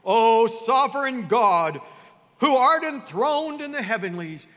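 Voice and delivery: hammed-up holy orator addressing God; pompous prayer voice. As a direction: declaim a showy King James prayer. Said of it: "they change the tone of their voice".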